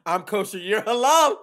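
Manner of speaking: loud, high playful outburst, building; mock-arguing shout. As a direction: escalate a silly squabble to a yell. Mock playground argument escalating.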